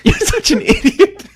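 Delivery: high, slow words through laughter; cracking up while scolding yourself; helpless laughing squeal; laughing self-reproach. Retold or imitated. Laughing at own outburst, retold.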